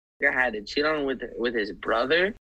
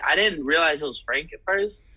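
usual speaking voice

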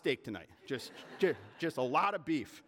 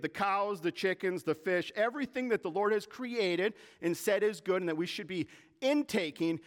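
normal talking voice

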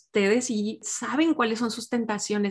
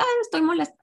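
mock complaint; 6 semitones higher, faster than usual. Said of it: "no nada más diga".

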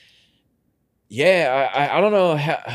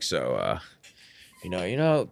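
low, quiet, hesitant cop impression; imitated cop's pitch; 7 semitones lower, 6 dB quieter than usual. Detective sounding out a suspect over two bags, low mock-casual opener. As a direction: ease in softly like a detective feeling out a suspect. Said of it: "The way they were talking".

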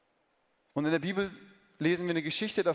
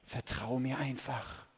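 much softer, slow serpent whisper; 5 semitones lower, 7 dB quieter, slower than usual. Acted. Snake whispering to Eve, hushed serpent voice. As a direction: breathe it into her ear. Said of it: "flüsterte die Schlange".

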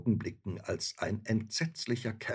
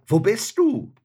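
high, loud searching call; 11 semitones higher, 11 dB louder than usual. A narrator's reading. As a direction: call out loudly to someone hidden. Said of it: "Da rief er".

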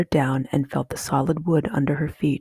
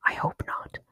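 tiny nervous whisper; faint hushed whisper; 7 dB quieter than usual. A narrator's reading. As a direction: barely breathe it back. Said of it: "She whispered back".

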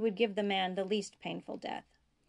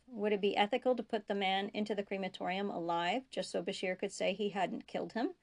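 usual speaking voice

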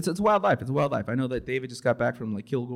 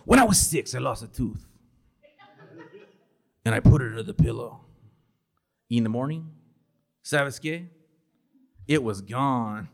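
mock earnest answer; 2 semitones higher, 4 dB louder than usual. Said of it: "To which I typically respond".

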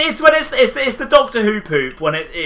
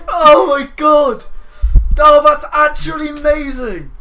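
delighted shout; 7 semitones higher, 4 dB louder, slower than usual. Real, not acted.

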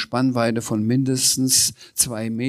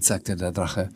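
ordinary delivery, nothing marked

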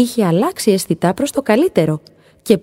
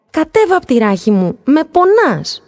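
higher, louder cry of pain; agitated shout; 10 semitones higher, 4 dB louder than usual. A narrator's reading. Dolphin shouts at the star on his back, agitated. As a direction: yell it flustered, he's being hurt. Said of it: "είπε φωνάζοντας ταραγμένα το δελφίνι".

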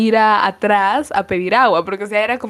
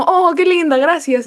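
gushing thanks; 7 semitones higher, 3 dB louder than usual. Retold or imitated. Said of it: "y yo como".

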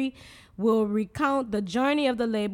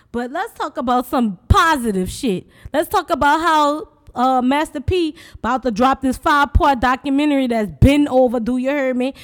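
excited; 3 semitones higher, 7 dB louder than usual. Real, not acted. Excited lead-in to the news.

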